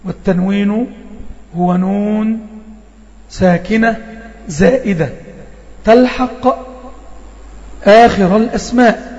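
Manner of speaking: slow measured recitation of the definition; teacher reciting a tajweed text. Shown as a demonstration. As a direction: chant the rule slowly, word by word. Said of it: "الشيخ هنا بيقول".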